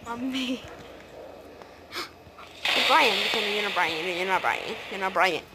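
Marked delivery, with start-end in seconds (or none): none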